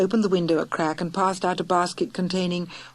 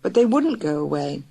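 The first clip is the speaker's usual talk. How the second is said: ordinary delivery, nothing marked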